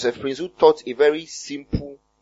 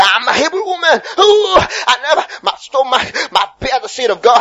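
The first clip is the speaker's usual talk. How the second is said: high, loud, wailing church declaration; hammed-up cry of faith; mock fervent confession; 8 semitones higher, 6 dB louder than usual. Mimicking a woman confessing during childbirth, wailing fervour.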